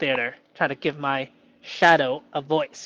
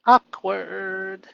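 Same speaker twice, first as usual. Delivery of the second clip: leaning into awkward; 4 semitones higher, 5 dB louder than usual. Pretend. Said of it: "And lean into it".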